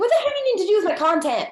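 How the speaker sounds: high, loud and fast, in disbelief; a hammed-up what-the-hell rant at a troll; exasperated outburst. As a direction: fire it back, fed up and baffled. Acting out reply to hater.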